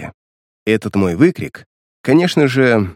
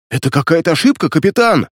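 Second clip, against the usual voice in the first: high, alarmed pleading cry; frightened guard; 6 semitones higher than usual. Acted. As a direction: cry out in panic. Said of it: "воскликнул перепуганный гвардеец".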